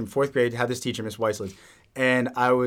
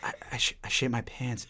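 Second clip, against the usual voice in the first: hushed confession, voice dropped; embarrassed whispered admission; 2 semitones lower, 11 dB quieter than usual. Retold or imitated. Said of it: "I whisper in her ear".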